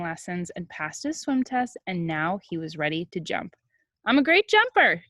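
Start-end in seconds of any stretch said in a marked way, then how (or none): 4.1-5.0 put-on high, louder proud kid voice; boastful kid. A narrator's reading. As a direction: brag like a boy hiding his nerves. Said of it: "said Jabari".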